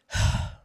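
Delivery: a loud, fed-up sigh to show inner grumbling; demo sigh. Shown as a demonstration. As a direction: sigh out heavily as if exasperated. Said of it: "It's more like this".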